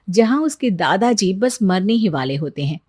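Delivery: ordinary delivery, nothing marked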